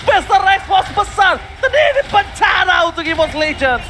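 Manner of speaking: hyped esports play-by-play, loud; fighting game announcer. Acted. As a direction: yell the teamfight call, high and loud.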